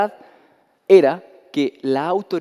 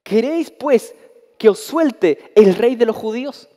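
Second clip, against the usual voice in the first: loud, higher public proclamation; Pilate to the crowd; 4 semitones higher, 4 dB louder, slower than usual. Acted. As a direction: call it out to the whole crowd. Pilate's offer repeated.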